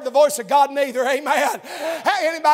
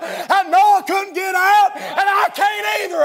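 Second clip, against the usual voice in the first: higher, drawn-out preacher's cry; full-force preaching; 4 semitones higher, 4 dB louder than usual. Real, not acted. Preacher testifying to congregation how he got in, impassioned shout. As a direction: build to a ringing shout from the pulpit.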